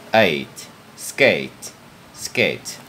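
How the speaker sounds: Eight then skate, each word said apart and clear; model pronunciation. Shown as a demonstration. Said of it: "sẽ phát âm là eight".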